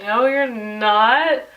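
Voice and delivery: slow, drawn-out refusal to buy it; unconvinced retort. Retold or imitated. Girl retelling her answer to her friend's apology, slow and unconvinced. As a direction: draw it out, unconvinced.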